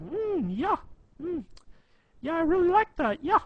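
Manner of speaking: high, loud complete-idiot voice; Adam voice. Shown as a demonstration. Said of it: "that's our Adam voice".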